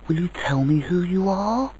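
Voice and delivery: put-on high child voice, questioning lift at the end; excited, frightened child. A narrator's reading. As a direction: plead as an anxious, eager child. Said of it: "both excited and quite frightened".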